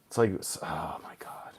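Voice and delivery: unvoiced whisper of disbelief; exasperated whisper. Real, not acted. Streamer whispering disbelief at the coach's plan. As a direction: breathe it out in disbelief.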